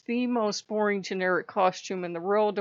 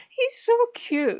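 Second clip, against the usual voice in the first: much higher, cooing little doll voice; playful doll voice gushing over the demon; doll's cooing voice; 4 dB louder than usual. Retold or imitated. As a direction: coo it like a tiny doll gushing. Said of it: "his little doll starts going".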